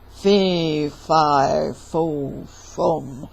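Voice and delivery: low, loud giant boom. Acted. Giant announcing his presence to Jack, booming. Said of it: "heard a booming voice".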